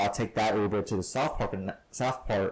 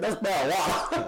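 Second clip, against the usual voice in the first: put-on Tennessee accent; 6 semitones higher than usual. A cousin deadpans in a Tennessee accent. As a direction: imitate a Tennessee cousin's twang, laughing. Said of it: "Like that Tennessee accent".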